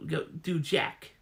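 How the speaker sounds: usual speaking voice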